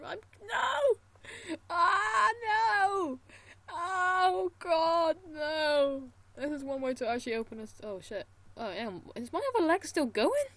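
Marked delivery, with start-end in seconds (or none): 0.0-6.1 anguished cries of no; high pained wailing; terrified gibbering. Real, not acted. Losing arm in game, crying out.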